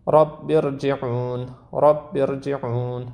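رب ارجعون with the ر of ارجعون thick and heavy. Shown as a demonstration. Quran teacher demonstrating a heavy R to students. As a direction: recite ارجعون with a full-mouthed, heavy ر. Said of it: "را رو باید تفخیم کنید".

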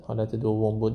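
ordinary talk

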